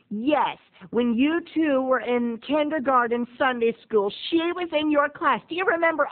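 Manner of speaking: high-pitched motherly reminding; voiced mom. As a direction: prompt him like a cheery mom. Mother reminding her son.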